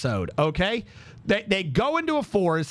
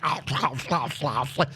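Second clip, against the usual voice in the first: low, repeated chomping noises; mock munching sounds; imitated chewing; 5 semitones lower, faster than usual. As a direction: mimic someone chomping away greedily. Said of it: "just chewing the fuck out of this".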